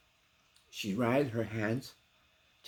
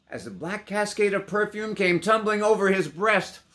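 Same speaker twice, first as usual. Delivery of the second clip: rising excitement; 10 semitones higher, 10 dB louder than usual. A narrator's reading. Building passion in narration.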